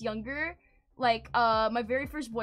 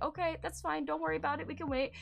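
softer, easygoing reassurance; nice boyfriend reassuring; 6 dB quieter, faster than usual. Retold or imitated. Boyfriend reassuring her after refusal, gentle and quiet. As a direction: let it drop soft and understanding. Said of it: "but he's very nice".

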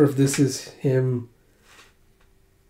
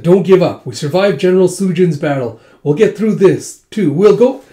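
raised, higher encouraging call; rallying cry; 4 semitones higher, 6 dB louder than usual. Retold or imitated. Lord to his surrounded forces, rallying. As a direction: push the troops to keep going.

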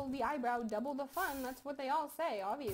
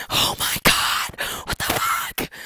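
breathless shock; 16 dB louder, slower than usual. Real, not acted. Shocked in game.

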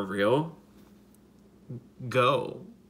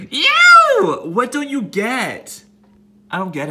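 loud, slow, higher exasperated yell; 12 semitones higher, 14 dB louder, slower than usual. Real, not acted. Yelling at the wasp, fed up it won't leave. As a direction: drag it out, fed up.

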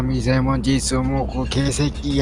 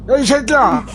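higher, louder furious yell; angry yell; 9 semitones higher, 5 dB louder than usual. Pretend. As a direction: yell it at him in a rage. Yelling at the king of hell.

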